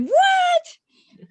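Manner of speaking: a disbelieving shriek of What; stunned squeal. Retold or imitated. Librarian imitating a patron's high, loud, stunned disbelief.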